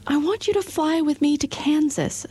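put-on high girl's voice asking a favour; Dorothy asking. A narrator's reading. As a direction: plead hopefully like a young girl. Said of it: "said Dorothy".

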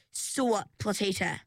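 usual speaking voice

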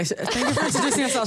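fast, laughing through the words; amused do-over; laughing retake. Real, not acted. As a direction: restart the thanks, giggling.

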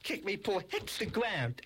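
ordinary delivery

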